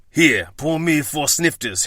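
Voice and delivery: slow, lightly put-on French accent; a hint of a French chef's accent. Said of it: "I know I do a terrible French accent".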